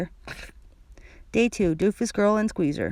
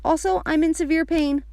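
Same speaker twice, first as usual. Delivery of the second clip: high, mildly shouted whine; mock shout; 8 semitones higher than usual. A narrator's reading. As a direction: call out a kid's complaint. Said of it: "I shouted".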